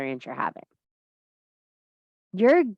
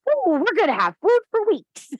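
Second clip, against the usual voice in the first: put-on high, louder, gleeful child's voice; imitating an excited daughter, much higher; 12 semitones higher, 5 dB louder than usual. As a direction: squeal it like a delighted little girl. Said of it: "She's excited".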